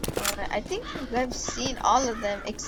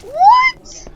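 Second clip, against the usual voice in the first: very high, loud shocked what; shocked shriek; 12 dB louder than usual. Real, not acted. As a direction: shriek it in disbelief. Shocked gamer.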